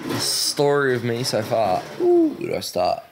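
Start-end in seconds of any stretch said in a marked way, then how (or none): none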